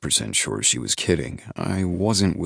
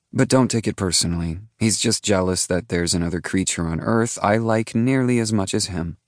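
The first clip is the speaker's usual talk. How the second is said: quick, playful female voice; Kirby's voice; 4 semitones higher than usual. A narrator's reading. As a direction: tease him fast and lightly. Kirby teasing the narrator.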